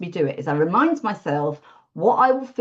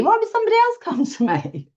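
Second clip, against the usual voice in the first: pitched much higher, disbelieving; incredulous self-doubt. Retold or imitated. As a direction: voice your inner doubt in a high, unbelieving voice. Voicing her own self-doubt.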